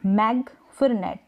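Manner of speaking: "मैग" then "नेट", g sounded; syllable split. Shown as a demonstration. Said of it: "अलग-अलग syllable में आ रहा है".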